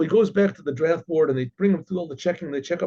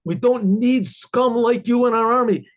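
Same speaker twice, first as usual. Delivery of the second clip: louder and higher, slow and scornful; contemptuous outburst; 6 semitones higher, 4 dB louder, slower than usual. Retold or imitated. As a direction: spit each word out with contempt. Dentist's rant peaks.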